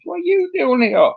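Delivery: very high, incredulous squeak of a question; acting out startled alarm; retold incredulity. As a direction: repeat the question in high-pitched disbelief. Retold shock at unexpected visitor.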